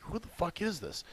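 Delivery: mock whisper, breathy and hushed; whispered reaction. Retelling an odd work email, a hushed whisper. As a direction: whisper it like a baffled aside.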